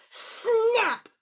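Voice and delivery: sharp high snap effect; loud snap. Acted. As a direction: snap like a breaking string. Strings break sound effect.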